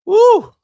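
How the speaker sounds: loud high whoop. Real, not acted. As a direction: whoop like a fan cheering. Cheer before market open.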